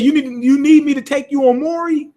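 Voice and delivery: high, startled disbelief; shocked. Retold or imitated. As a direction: blurt it out in shock, voice jumping up. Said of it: "And he was just shocked".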